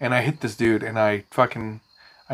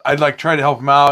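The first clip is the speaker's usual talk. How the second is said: ordinary delivery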